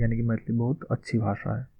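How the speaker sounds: normal talking voice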